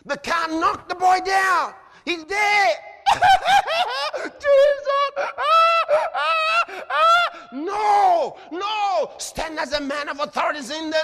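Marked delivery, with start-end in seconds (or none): 0.0-9.1 mock panic, high and loud with screams; panicked outcry. Preacher acts a bystander seeing the boy killed, in mock panic. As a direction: ham up a frantic bystander crying out.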